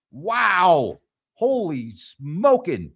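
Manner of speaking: a stunned wow, then drag out holy smokin' slowly; wide-eyed real surprise; amazed. Real, not acted. Reacting to submarine figures.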